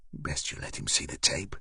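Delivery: whispered aside. A narrator's reading. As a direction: lean in and whisper it. Unvoiced aside to shopkeeper.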